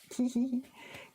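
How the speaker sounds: light, high giggle; small amused tee-hee laugh; little giggle. Real, not acted. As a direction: giggle to yourself. Amused while working.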